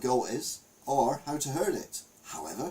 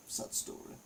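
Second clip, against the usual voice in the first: mock sad whisper; 10 dB quieter than usual. Mock mournful aside on seal.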